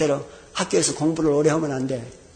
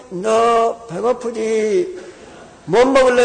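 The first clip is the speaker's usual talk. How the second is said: very slow then bursting out, higher; hesitant gentle offer; 5 semitones higher, 4 dB louder, much slower than usual. Retold or imitated. Father finally offering his son food, slow and hesitant. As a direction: ask slowly, then blurt it kindly. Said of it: "고개를 들으면서 뭐라고 하는 거니".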